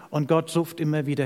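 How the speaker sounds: ordinary talk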